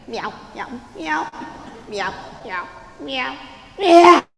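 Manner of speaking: mock meows, small then one loud wail; dejected cat. Rejected Señor Gato leaving Martina, dejected cat meows. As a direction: meow mildly, then burst into a yowl.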